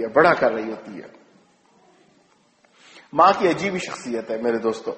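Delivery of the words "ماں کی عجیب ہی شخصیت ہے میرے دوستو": ordinary talk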